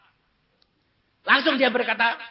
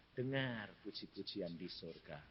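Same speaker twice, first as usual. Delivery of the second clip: lower, much softer; hushed voice; 12 semitones lower, 20 dB quieter than usual. Retold or imitated. God speaking softly to the preacher at midnight. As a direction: say it hushed like a voice in the night.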